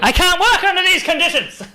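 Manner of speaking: high, loud, laughing mock outrage; hammed-up prima donna whine; mock diva complaint. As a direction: throw a fake tantrum. Mock diva complaint about mic.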